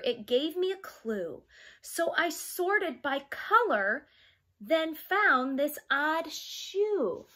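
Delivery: lively picture-book read-aloud; reading to children. Acted. Teacher reading a storybook to her young class, lively. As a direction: read it like storytime for little kids.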